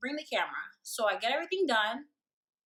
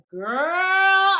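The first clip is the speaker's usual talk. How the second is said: put-on loud knowing girl; 7 semitones higher, 11 dB louder than usual. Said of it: "she's like".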